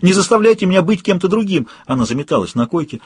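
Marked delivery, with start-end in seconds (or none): 0.0-1.7 high-pitched desperate wail. A narrator's reading. Woman terrified at who she has become, wailing. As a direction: plead frantically, pitch held high. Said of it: "завыла она".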